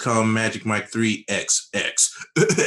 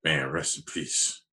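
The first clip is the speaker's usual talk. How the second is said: mock solemn; 7 semitones lower, 7 dB quieter, faster than usual. Mock solemn Vin Diesel tribute.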